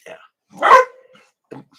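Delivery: very high, drawn-out squeal; a falsetto yelp of yeah; squeaky high yeah. Real, not acted. Squeaky reaction to chat.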